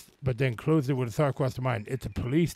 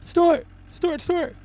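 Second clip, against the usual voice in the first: very high squeaky piggy snorts; high pig voice snorting between words; 7 dB louder, much slower than usual. Acted. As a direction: squeal it out like a startled piglet. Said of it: "gasped Peppa".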